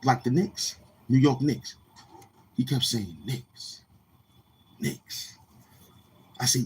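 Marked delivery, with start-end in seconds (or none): 3.2-5.4 breathy whisper of a name; barely voiced, like a secret; whispering the team name back. Man quietly repeats the team name.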